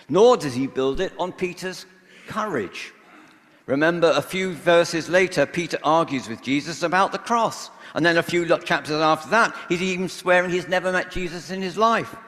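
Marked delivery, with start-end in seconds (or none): none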